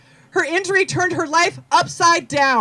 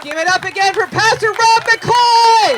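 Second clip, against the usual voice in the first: loud; 5 semitones higher, 6 dB louder than usual. Real, not acted. Emcee calling crowd to cheer.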